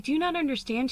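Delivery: retold exasperation in a high pitch; going off on someone. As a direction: mimic an incredulous friend laying into you. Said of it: "kinda went off on me".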